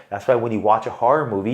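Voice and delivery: usual speaking voice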